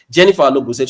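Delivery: normal talking voice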